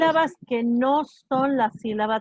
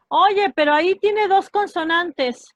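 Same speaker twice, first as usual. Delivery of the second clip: higher, slow mock objection; imagined student objection; 4 semitones higher, 4 dB louder, slower than usual. Teacher voicing a student's doubt about two consonants, lightly mock-puzzled objection.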